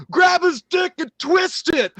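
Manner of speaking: put-on high, drawn-out drunk heckler shout; drunken yelling. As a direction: imitate a drunk yelling at the fighters. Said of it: "He's yelling at the octagon".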